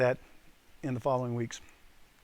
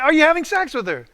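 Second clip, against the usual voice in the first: much higher, louder demand; raised-voice interrogation; insistent repeat; 13 semitones higher, 11 dB louder than usual. Retold or imitated. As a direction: re-enact hammering the question home. Asks again after he stares.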